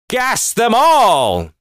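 mock dictator order. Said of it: "She's like".

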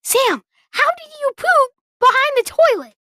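mock outraged little brother; high incredulous quote. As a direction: squeak it out in disbelief. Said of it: "this was me".

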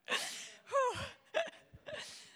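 high breaking sob-sigh; a real crying whimper rising up; high tearful moan. Real, not acted. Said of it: "I'm annoyed that I'm crying".

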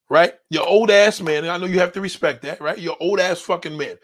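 normal talking voice

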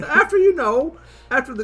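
high, bright tour-guide robot; little robot voice. Acted. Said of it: "this little robot dude is gesturing".

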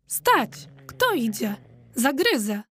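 ordinary delivery

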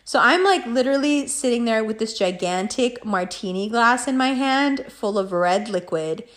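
ordinary delivery

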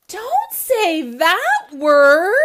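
comic refrain shout. A narrator's reading. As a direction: belt the refrain like a joke punchline. Comic refrain replacing rude word.

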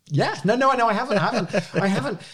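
high, quick flustered no no no; breathless high-pitched backpedalling with a laugh; flustered denial. Retold or imitated. Flustered reply, quoting herself.

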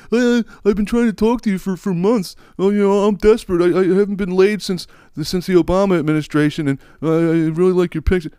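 high, whiny desperate pleading; desperate guy. Retold or imitated. Guy begging a woman in comments, whiny high plea. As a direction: whine it like a lovesick loser. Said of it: "go like".

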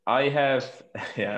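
usual speaking voice

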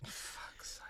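exasperated hushed whisper. Real, not acted. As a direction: breathe it out in disbelief. Aghast at friend taking pills.

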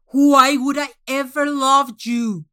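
loud, high monstrous scream. Acted. Manx screaming at the contestant, loud enough to cause a quake. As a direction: scream it so the room shakes. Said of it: "He screamed it".

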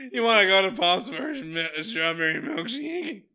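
loud, high, hammed-up awkwardness; Jason's awkward voice. Imagined Jason asking Cheryl out, awkward and loud. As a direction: belt it out in a silly put-on awkward voice. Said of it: "does the actor have an awkward voice".

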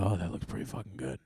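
low-volume trailer verdict to a friend; acted quiet approval in the cinema; hushed aside. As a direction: say it softly, as if mid-screening. Muttering to a friend during trailers.

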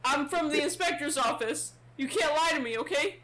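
high, loud, rattled interrogation; panicky guard questioning. Acted. Guard demanding a name from the stranger, high and frantic. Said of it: "He's like".

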